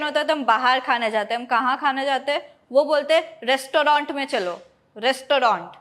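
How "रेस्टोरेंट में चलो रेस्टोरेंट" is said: रेस्टोरेंट in three syllables, res-to-RAUNT; mispronounced like parents. Retold or imitated. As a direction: say रेस्टोरेंट with a full 'to'. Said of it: "mispronounce करते हैं".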